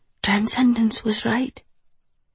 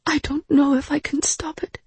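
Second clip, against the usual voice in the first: high, helpless voice; tearful helplessness; 8 semitones higher than usual. A narrator's reading. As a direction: admit it helplessly, pitched high. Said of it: "Her cheeks were wet then".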